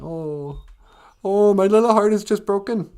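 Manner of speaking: high, hammed-up wail; mock crying. Orphaned boy climbs down after parents fall, mock crying. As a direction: wail like a heartbroken kid, overdone. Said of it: "he's crying".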